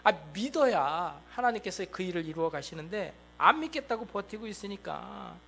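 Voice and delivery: normal talking voice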